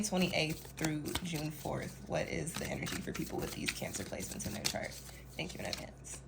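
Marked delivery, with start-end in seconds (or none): 2.1-6.2 soft whisper, low and hushed; hushed, breathy reading of a question. Real, not acted. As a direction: read the question in a hush.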